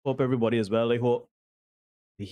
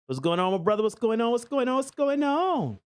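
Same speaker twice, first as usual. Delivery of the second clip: hyped-up greeting; 11 semitones higher than usual. Real, not acted. Host welcoming his brother from another mother, excited high-pitched greeting. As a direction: sing out a big welcome, climbing in pitch.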